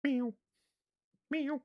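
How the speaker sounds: high cat meow. Pretend. Owner meowing back at his cat up high.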